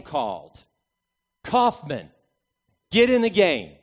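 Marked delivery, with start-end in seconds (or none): 1.4-3.7 loud barked call from the bench; coach's yell. Retold or imitated. Coach calling a player off the bench, loud shout. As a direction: shout the order across the gym.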